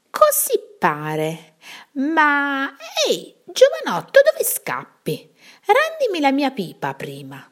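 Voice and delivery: put-on old witch chuckling, then demanding her pipe; cackling witch. A narrator's reading. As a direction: laugh and call the boy back. Witch laughs, calls him back.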